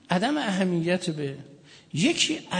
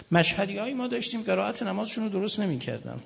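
usual speaking voice

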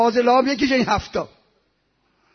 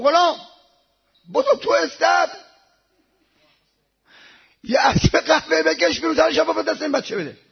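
high, raised-voice order to a servant; acted master's summons; commanding call; 7 semitones higher, 6 dB louder than usual. Master urgently orders his servant.

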